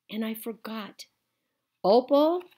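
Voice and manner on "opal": loud call from the porch. A narrator's reading. The preacher calls his daughter from the porch, loud. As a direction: call her name into the yard. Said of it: "I heard the preacher call".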